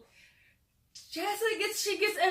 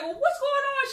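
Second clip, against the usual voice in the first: startled; 4 dB louder than usual. Retold or imitated. Girls react to shove.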